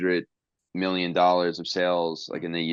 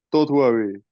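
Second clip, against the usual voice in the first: mock French accent, higher and louder; 5 semitones higher, 6 dB louder than usual. Retelling Andre's reply to Hulk, in a mock French accent. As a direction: put on a rough French accent. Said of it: "Is that your French accent".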